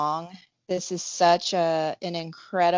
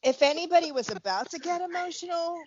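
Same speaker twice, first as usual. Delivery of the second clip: laughing; 12 semitones higher, faster than usual. Real, not acted. Joking while laughing.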